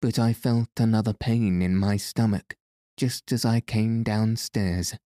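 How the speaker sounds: usual speaking voice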